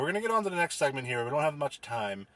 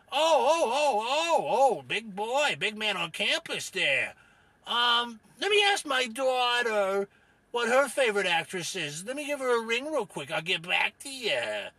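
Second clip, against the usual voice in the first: mocking Rufus character; 7 semitones higher than usual. Rufus ribs know-it-all host, mocking character voice. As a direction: jeer at the host in character, stretching it.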